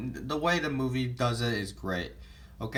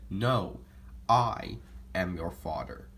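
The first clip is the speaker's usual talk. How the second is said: lower, heavy villain reveal; Darth Vader impression; 5 semitones lower, slower than usual. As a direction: drop the voice low for the big reveal. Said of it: "this is how he said it".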